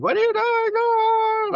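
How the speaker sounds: very high, loud, slow stretched mock yell; imitating a yell. Son yelling at dad packing his suitcase, high stretched mock yell. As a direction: shriek it like an outraged teen. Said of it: "he's yelling at me like".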